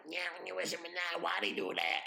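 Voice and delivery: high-pitched garbled creature voice; talking spider. Retold or imitated. Spiders talking in Harry Potter, high creature impression. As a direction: chitter the words like a movie spider.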